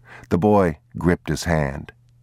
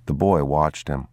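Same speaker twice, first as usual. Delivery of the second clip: usual speaking voice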